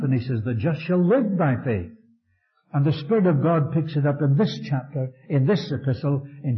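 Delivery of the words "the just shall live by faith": "live" carries the weight of the line; stress on LIVE. Shown as a demonstration. Preacher showing Paul's Galatians reading, weight on "live". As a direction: lean on "live", keep "just" and "faith" light. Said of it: "There he put the emphasis on the life".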